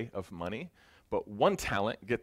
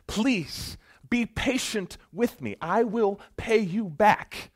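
slow, high, loud desperate begging; desperate plea; 10 semitones higher, 7 dB louder, slower than usual. Acted. Choked servant crying out to his creditor, slow and desperate. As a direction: cry out for mercy while being choked. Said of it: "the exact same thing that this other servant said".

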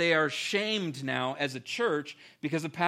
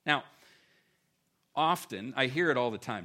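ordinary delivery

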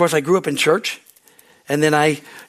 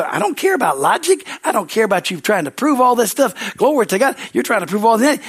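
fired-up retort; 7 semitones higher than usual. Retold or imitated. Pastor recounts his heated reply.

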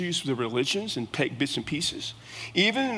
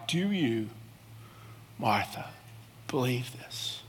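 soft, hushed, lower at Martha; gentle question; 3 semitones lower, 7 dB quieter, slower than usual. Acted. Preacher voicing Jesus asking Martha, hushed and gentle. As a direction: ask it softly, leaning in.

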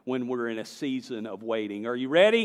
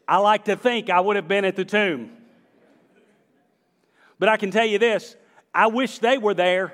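raised, punchy pulpit voice; emphatic preaching; 5 semitones higher, 7 dB louder than usual. Real, not acted. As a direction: preach it out to the congregation.

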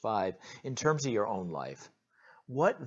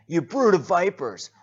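much higher, much louder shout; preacher repeating John's insult, shout; 8 semitones higher, 11 dB louder than usual. Acted. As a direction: fling it out again. Another loud repetition.

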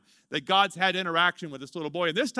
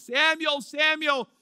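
both names raised evenly; lightly voiced divine call; 7 semitones higher, slower than usual. Shown as a demonstration. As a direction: call out twice, steady and raised. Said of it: "but he says it twice".

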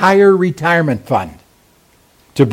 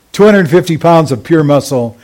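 ordinary talk, nothing marked

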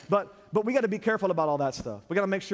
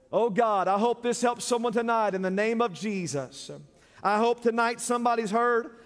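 raised, impassioned preacher's prayer; fervent prayer; 6 semitones higher, 4 dB louder than usual. Real, not acted. Pastor asking God to help someone tonight, fervent prayer. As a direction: pray out loud with high, pressing fervor.

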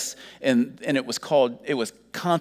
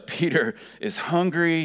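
ordinary talk, nothing marked

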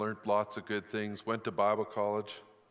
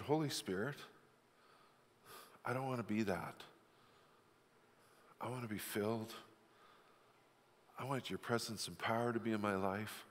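hushed prayer; 9 dB quieter than usual. Retold or imitated. Pastor retelling his morning prayer, soft and hushed. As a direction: pray it softly, half to yourself.

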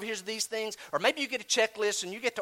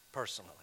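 "Personally" set apart by long pauses, low and soft; a pointed, hushed "Personally"; emphasis on Personally; 8 semitones lower, 11 dB quieter, much faster than usual. Real, not acted. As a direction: drop "Personally" quietly on its own. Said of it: "I'm emphasizing the word personally".

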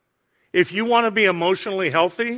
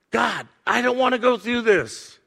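higher-pitched acted plea to God; protesting prayer; 5 semitones higher than usual. Showing how to pray like Jesus, in pained protest. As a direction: voice the honest protest before surrender. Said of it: "after you scream, after you yell".

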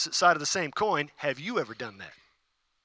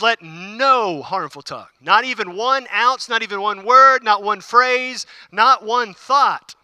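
slow, louder, each not one pressed harder; building preacher emphasis; 2 semitones higher, 5 dB louder, slower than usual. Real, not acted. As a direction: drive each phrase home slowly.

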